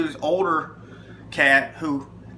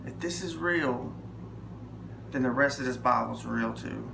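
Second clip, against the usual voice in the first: softly praying to the Lord; quiet prayer; 4 semitones lower, 8 dB quieter than usual. Retold or imitated. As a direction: pray it low and sincere. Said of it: "I said".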